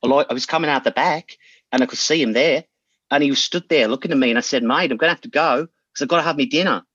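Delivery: low-pitched Kiwi storytelling; New Zealand accent. Acted. Player building a story that ends on dinner, New Zealand accent. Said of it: "your best New Zealand accent".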